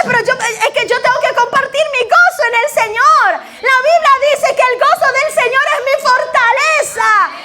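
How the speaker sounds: excited preaching. Acted. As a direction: rouse the congregation, laughing with joy. Pastor fires up congregation.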